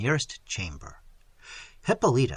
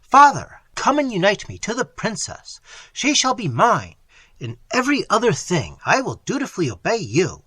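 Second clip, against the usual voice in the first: loud, high urgent plea; begging the friar to marry them, loud and high; 6 semitones higher, 6 dB louder than usual. Acted. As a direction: press the friar hard. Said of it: "continued Theodore".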